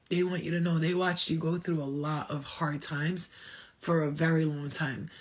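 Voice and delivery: usual speaking voice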